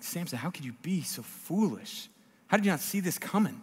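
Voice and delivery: hushed, incredulous plea with swooping pitch; exasperated disbelief. Acted. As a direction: softly scold him in disbelief. Exasperated reader scolding Samson.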